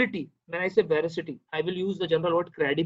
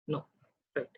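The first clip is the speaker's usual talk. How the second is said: usual speaking voice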